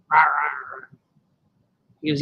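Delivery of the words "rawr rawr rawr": odd little growls, lower; growling at girl. Acted. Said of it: "He growls at her".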